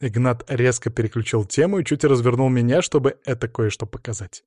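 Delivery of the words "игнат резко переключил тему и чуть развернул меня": ordinary delivery, nothing marked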